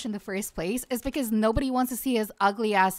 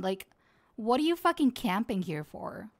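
usual speaking voice

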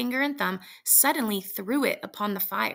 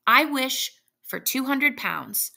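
slow, louder and very distinct; clearly; 5 semitones higher, 5 dB louder, slower than usual. Acted. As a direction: pronounce each word slowly and firmly. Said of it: "said the old man clearly".

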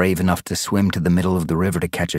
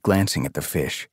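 ordinary delivery

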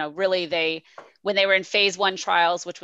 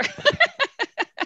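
very high burst of laughter; real high-pitched laugh; 4 dB louder than usual. Real, not acted. Laughs after sarcastic answer exchange.

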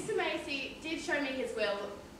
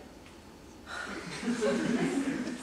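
short laugh; 5 semitones lower than usual. Acted. Amused at the bedpan joke.

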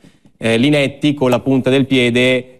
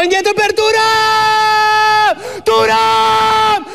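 screaming goal call, very high and drawn out; breathless Italian goal commentary; slower than usual. Real, not acted. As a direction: yell the goal like a radio commentator. Live goal call for Inter.

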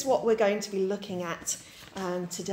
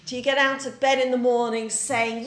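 normal talking voice

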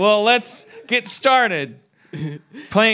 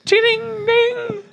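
mock bell chime; 14 semitones higher, slower than usual.

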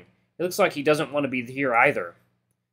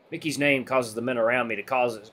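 ordinary delivery

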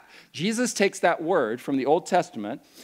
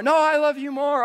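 much higher, squealy mock lover; "No, I love you more" pitched way up, gushing; mock gushing sweet talk; 12 semitones higher, 4 dB louder than usual. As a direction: outdo the partner in gushing. Mock couple banter escalating.

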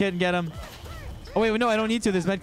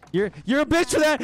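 panicked; 7 semitones higher, 3 dB louder than usual. Real, not acted. Repeat of line 32 louder.

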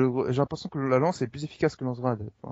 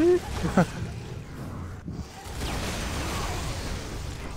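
high breathy laugh; 5 dB quieter than usual. Real, not acted. After dying, high laugh.

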